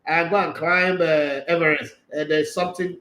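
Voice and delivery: ordinary talk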